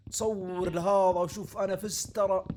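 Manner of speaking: mock gloat. Gloating over a won game.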